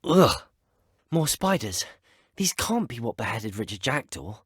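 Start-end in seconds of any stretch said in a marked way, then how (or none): 0.0-0.3 short, higher, louder grunt of revulsion; disgusted ugh. Acted. Explorer finds more spiders in the cave, disgusted ugh. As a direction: recoil with a loud "ugh".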